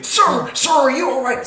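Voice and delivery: high, urgent call of concern; alarmed bystander calling up to someone; worried calling. Acted. Bystander calls up to floating man.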